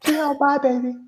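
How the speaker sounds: high sweet singsong farewell; imitating the nurse's goodbye. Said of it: "She thinks I'm waving goodbye".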